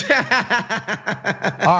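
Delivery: laughing through the words; real laughter spilling into the words. Real, not acted. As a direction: still chuckling at his own joke. Laughing at his own joke.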